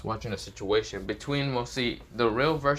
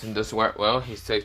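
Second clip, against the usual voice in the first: ordinary delivery, nothing marked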